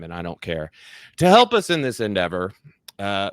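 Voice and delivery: ordinary delivery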